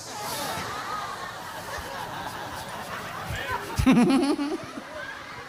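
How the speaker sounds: a high, long burst of laughter; hearty laugh. Real, not acted. Preacher laughing at his own joke to the crowd, high and free. Audience-facing joke about being a council member.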